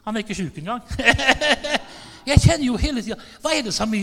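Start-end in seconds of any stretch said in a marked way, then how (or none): 0.9-2.2 real laugh, higher pitched; hearty laugh. Real, not acted. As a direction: burst into rapid chuckles. Laughs at his own remark.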